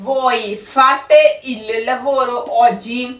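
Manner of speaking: very slow Italian words; slow sentence reading. Shown as a demonstration. Teacher slowly reads the fourth exercise sentence. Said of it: "ਪੰਜਵਾਂ ਸਾਡਾ ਹੈਗਾ sentence".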